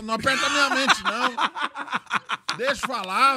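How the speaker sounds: high, loud laughing complaint; laughing protest. Real, not acted. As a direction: protest while cracking up.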